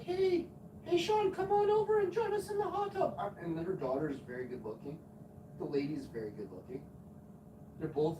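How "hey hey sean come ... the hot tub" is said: very high put-on women's voice calling over; hammed-up hot-tub flirts; flirty neighbour women. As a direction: coo an invitation in mock falsetto.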